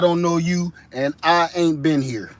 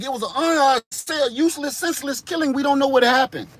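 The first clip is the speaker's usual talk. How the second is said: grieving neighbor; 6 semitones higher than usual. Acted. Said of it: "everybody like".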